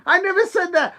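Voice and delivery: "I never said that" shouted high and loud; loud denial. Real, not acted. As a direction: yell "never" high at the chat. Denying a troll in chat.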